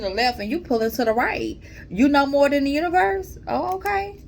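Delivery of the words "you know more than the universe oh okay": sarcastic disbelief. Real, not acted. Teasing viewers who resist the universe.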